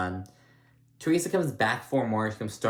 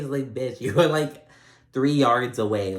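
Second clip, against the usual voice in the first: laughing; 3 semitones higher, 6 dB louder than usual. Real, not acted. Laughing at Teresa's overreaction.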